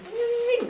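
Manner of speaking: very high zing of a flying spear; mock whoosh. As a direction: zip past like a spear. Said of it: "goes all Matrix".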